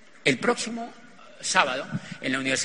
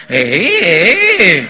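loud grunted eh eh eh; husband's grunts; 3 semitones higher, 15 dB louder, much slower than usual. Retold or imitated. Husband beside his raving wife, loud grunting. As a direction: grunt loudly as if cutting in.

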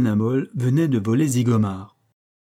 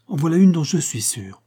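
pleased with himself; 6 semitones higher, 4 dB louder than usual. A narrator's reading. Ben Hamol pleased with photo.

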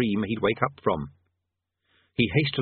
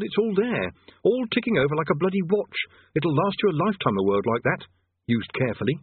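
mildly exasperated pitch, climbing higher through the list; exasperated salesman wizard; 7 semitones higher than usual. Acted. Rincewind selling the world to the boy, mildly exasperated. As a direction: talk up the goods to a doubtful customer.

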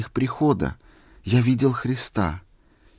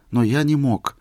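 plain reading voice

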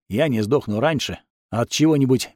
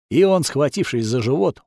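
plain reading voice

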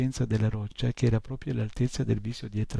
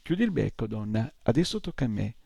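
plain reading voice

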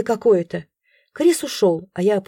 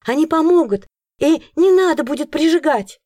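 urgent plea; 8 semitones higher, 4 dB louder than usual. A narrator's reading. Heroine pleads urgently.

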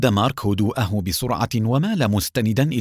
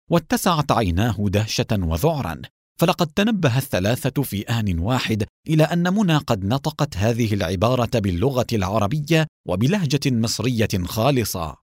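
ordinary delivery

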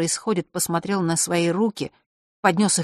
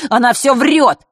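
put-on louder, insistent boy's cry; urgent protest; 7 semitones higher, 9 dB louder than usual. A narrator's reading. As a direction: blurt it out in alarm. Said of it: "не выдержал Ник".

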